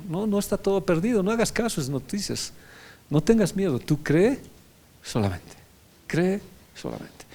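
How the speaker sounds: lightly voiced Jesus telling Jairo not to fear; Jesus comforting Jairo, a touch of tender urgency; a hint of Jesus reassuring. Jesus' words to Jairo quoted.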